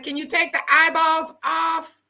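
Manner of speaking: pleading request. Retold or imitated. Retelling her request.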